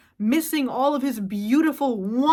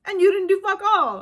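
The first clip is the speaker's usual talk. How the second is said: much higher and louder yell; loud outburst; 8 semitones higher, 5 dB louder than usual. Real, not acted. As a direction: shout them down. Ranting at AI users.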